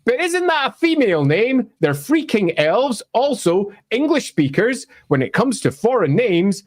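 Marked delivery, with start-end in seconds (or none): 0.1-3.0 confused fan. Retold or imitated. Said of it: "you might be confused by is".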